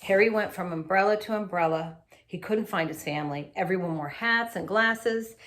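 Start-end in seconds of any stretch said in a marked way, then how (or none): none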